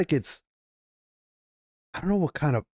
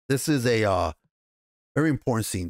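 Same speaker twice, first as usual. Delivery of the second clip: usual speaking voice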